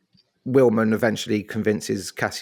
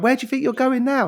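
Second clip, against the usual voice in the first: much higher, indignant "Where do you think you're going now"; mock officer yell. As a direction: squawk it like an exasperated boss. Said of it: "And he's just like".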